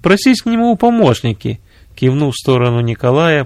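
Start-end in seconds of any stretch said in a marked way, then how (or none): 0.0-1.6 высокий голос жены генерала; посоветуй мужу высоким женским голосом; совет жены. A narrator's reading. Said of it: "посоветовала генералу жена". (жена советует, на высоких тонах)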